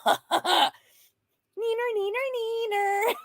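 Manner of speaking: high singsong "neener, neener, neener"; neener taunt. Real, not acted. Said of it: "taunting singsong".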